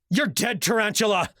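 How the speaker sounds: screamed threat. Acted. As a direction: lunge at him with a shrieked threat. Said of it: "I screamed".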